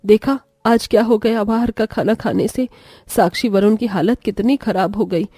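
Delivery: put-on low, soft scolding old woman; scolding grandma. Elder scolding daughter-in-law.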